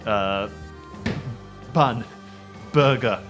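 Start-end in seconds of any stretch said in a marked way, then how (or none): none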